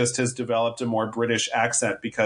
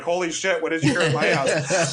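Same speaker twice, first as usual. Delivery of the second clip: stunned, slightly raised mock alarm; shocked officials; 4 semitones higher than usual. Officials at the table react to the wiretap, mock alarmed. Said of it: "everyone around the table is like".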